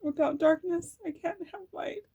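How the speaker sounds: on the edge of real tears, pushing through; tearful. Real, not acted. Tarot reader tearing up over her own healing, high and crying. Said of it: "I'm gonna cry".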